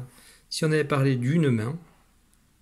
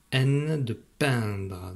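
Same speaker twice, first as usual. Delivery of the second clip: very slow, drawn out; much slower than usual. Shown as a demonstration. Teacher slowly sounding out peindre for a child. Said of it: "dans le mot peindre".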